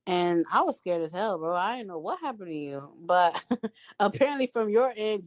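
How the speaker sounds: ordinary delivery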